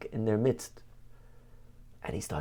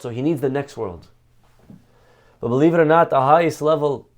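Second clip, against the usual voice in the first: preaching with force; 6 dB louder than usual. Acted. Rabbi urging listeners, preaching louder with force. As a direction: drive the lesson home from the pulpit.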